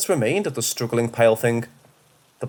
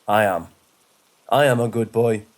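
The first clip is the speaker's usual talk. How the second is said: a hint of otherworldly dog; lightly voiced talking dog; 3 semitones lower than usual. A narrator's reading. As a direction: answer as an uncanny dog, measured and level. The demon dog suddenly speaks.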